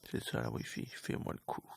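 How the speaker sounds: low, soft grumbling; muttering. Real, not acted. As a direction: grumble under your breath. Muttering frustrated aside.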